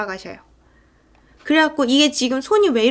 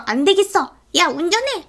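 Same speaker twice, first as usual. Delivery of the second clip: raised-pitch, acted-out angry order; mock angry outburst; 6 semitones higher than usual. Drunk villain orders his sidekick to drive, mock anger. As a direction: imitate a villain suddenly flaring up. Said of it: "갑자기 막 화를 내면서".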